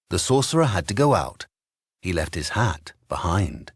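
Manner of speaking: plain reading voice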